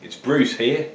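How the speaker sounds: put-on light, jokey Australian accent held throughout. Vlogger tasting beers for viewers in a put-on Australian accent. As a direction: play a cheerful Aussie bloke, Australian accent all the way. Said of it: "hence the old Australian, uh, accent and hat".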